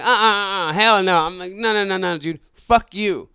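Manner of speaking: yelling him away from the car; angry refusal. Retold or imitated. Driver blocking the man from his car, angry outburst. Driver kicking out passenger, arguing.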